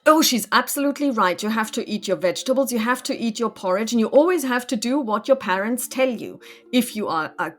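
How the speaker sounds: put-on loud, insistent lecture to a child; emphatic sermonizing, easing then punching the end; preachy priestess. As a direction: lay down the rules like a priestess. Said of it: "I'm a priestess".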